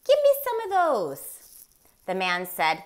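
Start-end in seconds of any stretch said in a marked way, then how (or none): 0.0-1.2 high, fast silly moose voice; a high-pitched cartoon moose asking for candy. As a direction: play the moose, eager and quick. Morris the moose asks for candy.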